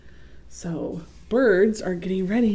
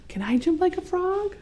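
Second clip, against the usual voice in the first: put-on eager child voice; 12 semitones higher than usual. Child asking in story.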